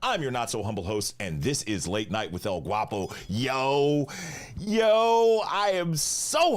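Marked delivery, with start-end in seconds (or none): 3.3-6.6 excited. Real, not acted. Host hypes up his stream.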